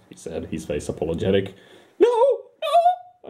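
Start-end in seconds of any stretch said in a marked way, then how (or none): 2.0-3.3 high, squeaky panicked outburst; nervous shriek. Acted. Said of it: "I shrieked, a short, nervous outburst".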